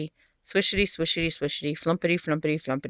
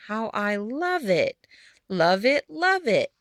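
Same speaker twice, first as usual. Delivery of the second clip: forced cheer; 3 semitones higher, slower than usual. Acted. Old woman catches herself, feigns joy.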